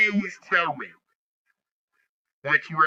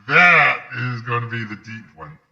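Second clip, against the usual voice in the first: deep voice; 5 semitones lower, 7 dB louder than usual. Shown as a demonstration. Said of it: "There's your deep voice".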